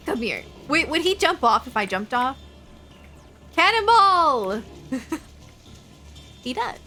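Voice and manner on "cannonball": cannonball yell. Real, not acted. Jumping off, shouting cannonball.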